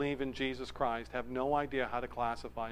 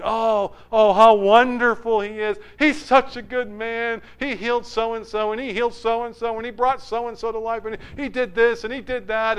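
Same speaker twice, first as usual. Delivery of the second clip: high, gushing praise of a fan in the crowd; admiring crowd member; 8 semitones higher, 7 dB louder than usual. Acted. A crowd member raving to his friends about Jesus, gushing and high. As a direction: rave about him, voice lifted high and bright. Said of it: "this group's out there saying".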